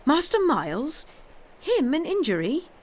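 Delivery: high, loud indignant outburst; flaring up in disbelief. Acted. Housekeeper defending young Miles to the governess, flaring up in loud disbelief. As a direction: fire up, shocked anyone could say it. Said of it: "she suddenly flamed up".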